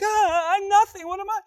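high, loud, hammed-up alarm; panicked. Mary startled by the excited angel, acted panic. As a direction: cry out in startled mock panic.

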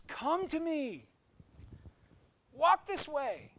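a gentle divine invitation, drawn out; voicing God. Pastor voicing God calling believers to follow, slow gentle invitation. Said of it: "He says".

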